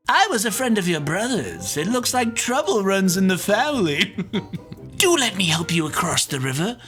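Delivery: sly, smooth, chuckling villain; sly fox. Acted. Devious fox luring the gingerbread girl onto his tail. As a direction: lure her in sweetly.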